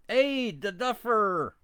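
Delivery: ordinary talk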